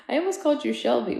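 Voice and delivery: usual speaking voice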